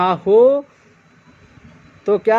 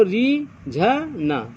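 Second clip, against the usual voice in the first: slow spelled-out word; much slower than usual. Shown as a demonstration. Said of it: "जैसे रीझना".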